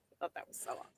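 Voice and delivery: breathy whispered delight. Real, not acted. Marveling to the host about the hundred dollars, whispered. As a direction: whisper it in awe. Awed aside after laughing.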